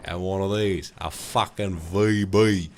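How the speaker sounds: put-on broad Australian accent, stretched vowels; hearty, slow Australian impression; Aussie guy. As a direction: drawl it like a true-blue Aussie bloke. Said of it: "that fucking Aussie guy".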